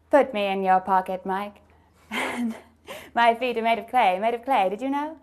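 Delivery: grand, clipped starlet delivery for fun; Katharine Hepburn. Acted. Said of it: "talk like Katharine Hepburn".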